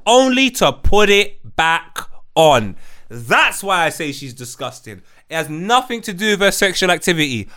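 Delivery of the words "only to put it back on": slow, louder, drawn-out outrage; slow disbelief. Real, not acted. Host recapping the clip to his co-host in slow disbelief. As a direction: spell it out slowly, appalled.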